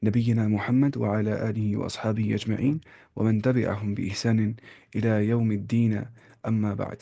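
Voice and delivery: measured, slow recited blessing; formal Arabic opening. Acted. Teacher opening a Quran lesson with slow recitation. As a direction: recite the opening praise with dignity.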